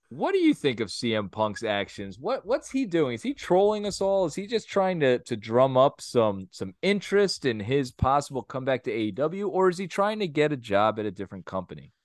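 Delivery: ordinary talk, nothing marked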